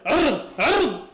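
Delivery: slow, drawn-out frustrated yell at the screen; frustrated shout. Real, not acted. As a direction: stretch each word into a loud groan. Yelling at player on screen. (صراخ ممطوط بغضب)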